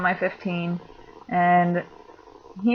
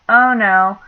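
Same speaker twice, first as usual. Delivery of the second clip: much louder dismayed oh no; alarmed yell; 9 dB louder than usual. Retold or imitated. On a smoke break hearing the tent crunch, a loud dismayed cry.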